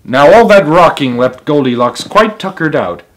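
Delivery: ordinary delivery, nothing marked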